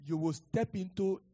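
loud prophetic declaration over music; thundering prophetic blessing; preaching at full force. Acted. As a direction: proclaim it with rising force.

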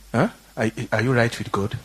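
ordinary delivery

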